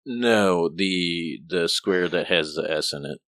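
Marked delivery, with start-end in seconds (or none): none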